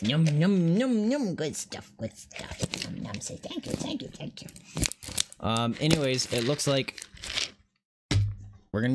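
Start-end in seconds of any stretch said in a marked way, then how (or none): 0.0-1.3 high sing-song yum to a pet. Real, not acted. Feeding pet treats. 1.3-4.5 whispering to pet. Real, not acted.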